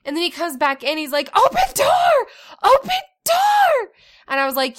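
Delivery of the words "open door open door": put-on very high, loud child yell; boy yelling at his mom to open the door, very high and loud; toddler shouting. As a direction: scream it like a frantic little boy.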